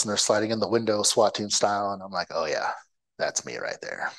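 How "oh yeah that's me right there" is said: low, soft starstruck murmur; lost in a daydream of being the hero; dreamy hushed awe. Retold or imitated. Recruit murmuring at the swat video in hushed awe.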